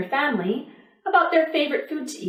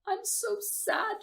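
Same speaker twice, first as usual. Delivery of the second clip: put-on high, soft, tearful little-girl voice; a hungry child weeping softly; little girl crying; 10 semitones higher, 9 dB quieter, slower than usual. A narrator's reading. A hungry little girl cries to the pancake, high and tearful. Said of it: "it heard the little girl crying".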